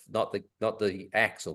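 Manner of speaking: normal talking voice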